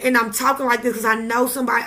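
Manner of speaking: ordinary talk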